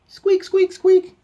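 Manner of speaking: very high, loud toy squeaks; squeaky toy imitation. Dog chewing its toy, high piercing squeaks.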